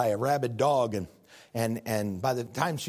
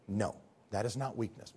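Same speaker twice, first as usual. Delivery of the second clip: soft, low, firm no; hushed correction of a teen boy; quiet correction; 6 semitones lower, 9 dB quieter than usual. Acted. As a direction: gently set him straight.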